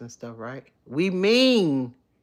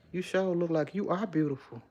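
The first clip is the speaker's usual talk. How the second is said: ordinary talk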